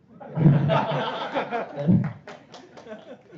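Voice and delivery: loud burst of high laughter; real laughter breaking out. Real, not acted. Host laughing at his own joke about God, loud.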